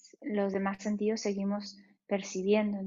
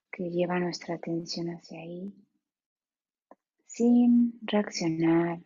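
guided meditation. Acted. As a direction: ease them into stillness, unhurried.

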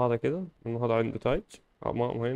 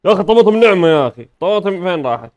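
loud, higher mock telling-off; mock scolding; 4 semitones higher, 12 dB louder than usual. Gamer scolds the baby for throwing the tomato, loud mock scolding. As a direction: scold the baby, half joking.

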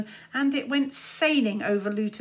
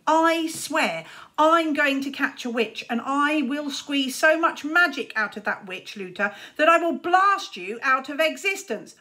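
put-on a boy vowing revenge on his rival; furious vow; 5 semitones higher, 5 dB louder than usual. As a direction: swear it through clenched rage. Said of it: "eyes so small with anger".

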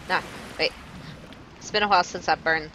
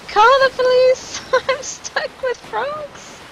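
very high, slow, laughing wail; laugh-cry it out, stretched and high; real giggly despair; panicked laughing; 5 dB louder, much slower than usual. Real, not acted. Stuck among frogs, laughing panic.

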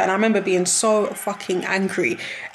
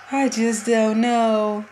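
slow, drained, resigned; resigned despair; much slower than usual. Real, not acted. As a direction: let it sink in hopelessly. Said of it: "I just, I just don't know".

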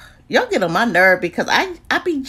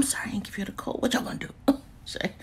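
sheepish soft whisper; hushed guilty apology; 10 dB quieter, much faster than usual. Real, not acted. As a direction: whisper your sorry with a giggle. Whispered apology for forgotten code.

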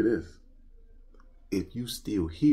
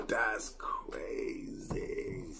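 breathy hushed awe at the lights; awed whisper; 12 dB quieter, much slower than usual. Real, not acted. Admiring the Batmobile lights, hushed awe. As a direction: whisper it in amazement.

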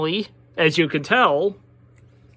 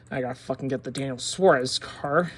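normal talking voice

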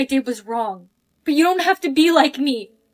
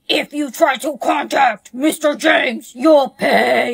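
scary guy; slower than usual. Retold or imitated. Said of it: "some big scary guy came to my house saying".

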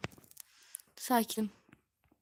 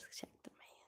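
whisper; 27 dB quieter than usual. Real, not acted. Confiding to viewers secretly.

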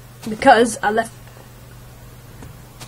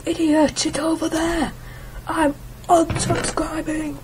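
a touch higher, mock exasperated viewer; annoyed viewer; 7 semitones higher than usual. Mocking a viewer who quits the channel, mildly exasperated. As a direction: lightly play the fed-up viewer. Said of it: "you were like".

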